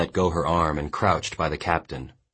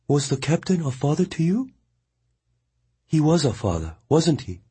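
higher, steady woman's voice; Mongnan consoling; 11 semitones higher than usual. A narrator's reading. Mongnan firmly consoling the weeping boy. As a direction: tell him firmly he died well.

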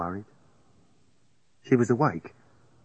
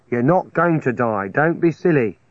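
firm; 4 semitones higher, 6 dB louder than usual. A narrator's reading. Firm reassurance to sick friend.

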